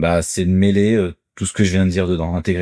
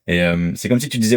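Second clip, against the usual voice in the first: usual speaking voice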